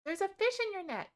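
high excited call. Real, not acted. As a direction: point it out eagerly. Excited spotting fish.

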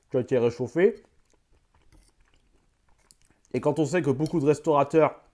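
eating mid-sentence; chewing while talking. Real, not acted. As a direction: mouth partly full. Seen 2: bite taken at -1s; jaw keeps working while he talks.

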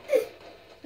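sudden frightened gasp; quick gasp of fright; sharp startled gasp. Real, not acted.

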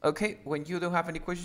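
ordinary delivery

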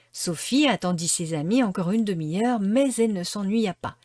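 plain reading voice

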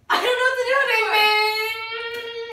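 fake crying wail. Fake despair to make laugh.

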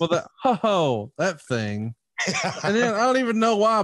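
can't stop giggling while talking; real giggles breaking into the line. Real, not acted. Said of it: "what are you giggling at?".

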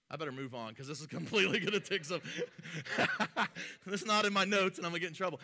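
hurried words breaking into a laugh. Real, not acted. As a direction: rush through it, amused at your own tangent. Laughs at his own tangent.

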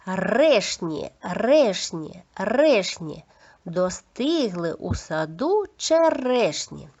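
trilled R in "Решні" and "черешні"; clear articulation. Shown as a demonstration. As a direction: drill "Решні" three times, then slowly stretch "черешні". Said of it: "Чітко вимовляйте, будь ласка, повторюйте".

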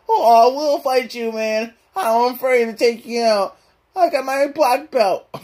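high cartoon slacker boasting; Shaggy. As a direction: brag as a high-voiced sidekick.

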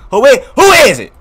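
high, loud demand; shouting at door. Acted. Player calling out to whoever is knocking, loud shout. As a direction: holler it toward the door.

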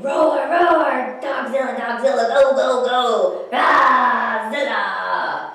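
loud, high roaring movie-fan cheer. Acted. Duck cheering Dogzilla at the movies, shouted roaring. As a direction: roar and chant wildly, stretching the last name out.

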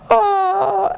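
high, open-mouthed yawn; acted yawn. Sleepless princess sitting up in bed, big yawn. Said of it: "and yawned".

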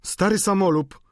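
high, loud insult; indignant cry. A narrator's reading. Landlady insulting the old lodger, loud indignant cry. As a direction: fling it at him, pitched high. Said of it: "zawołała pani Wauquez".

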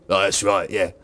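a low, booming comic deep voice; Steve's deep voice. Acted. Steve answers Mother in his deep comic voice. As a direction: rumble it out like a big-voiced hero. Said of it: "got a deep voice".

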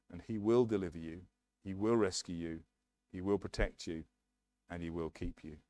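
ordinary talk

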